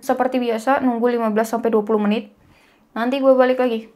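speaking under wet face sheet; wearing a sheet mask. Real, not acted. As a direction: lips slightly held by mask. Seen 0: Thin wet sheet mask covers whole face; mouth opening stays free.

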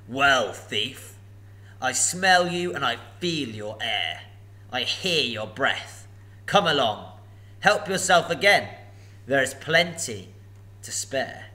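loud, slow, looming dragon voice; a huge dragon toying with a thief; booming sly dragon; menacing dragon. Acted. Dragon Smaug speaking.

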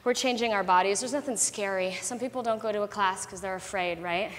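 quiet reassurance. Real, not acted. Quiet reassuring pep talk.

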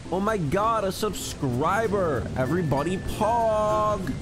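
rally the chat for the new sub; excited subscriber hype. Real, not acted. Streamer hyping chat over a new subscriber, excited call.

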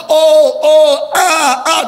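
hammed-up swooning sighs; mock emotional gushing. Preacher mocking emotional love, loud swooning oh and ah. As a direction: gush like fake feeling. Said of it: "It's not".

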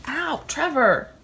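loud protest. Real, not acted. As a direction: cry out at him. Husband hitting her knee.

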